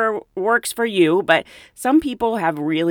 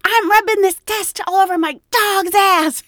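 high, repeated imitated tirade; mock furious rant; 13 semitones higher, 6 dB louder than usual. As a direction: mimic a coworker losing it. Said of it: "I think she said eight times".

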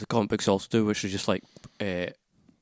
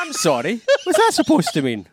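mock outrage; 13 semitones higher, 5 dB louder than usual. Mock offence at co-host's jab.

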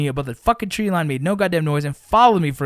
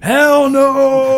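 mock scream, much higher and louder; retold screaming; 10 semitones higher, 7 dB louder than usual. Running from the UFO through the trees, retold scream. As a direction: yell it mid-sprint through the bushes. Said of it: "I think I'm still screaming".

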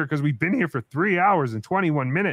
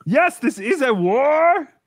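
mock rallying speech; 6 semitones higher, 5 dB louder, slower than usual. Mock war speech echoed.

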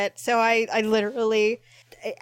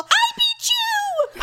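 high, loud, slow shouted gloat; victory yell; 4 dB louder, much slower than usual. Retold or imitated. Gloating at friends over the first pick, loud high yell. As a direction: yell it like a wild winner. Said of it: "uncontrolled, loud".

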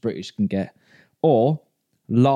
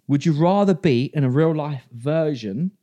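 usual speaking voice

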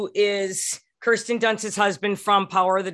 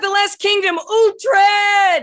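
excited shout; 12 semitones higher, 6 dB louder, slower than usual. Real, not acted. Fan cheering for the show.

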